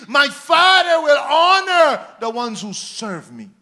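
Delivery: loud, slow, ringing scripture preaching; preaching at full force. Acted. Preacher proclaiming scripture to the church, loud full-force preaching.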